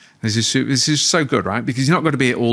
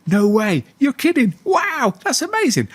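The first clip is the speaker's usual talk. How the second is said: much higher, gushing parent reactions; excited amazement; 14 semitones higher, slower than usual. Shown as a demonstration. Parent reacting to child's highlight, gushing and amazed. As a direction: rave like every word thrills you. Parent's eager responses demoed.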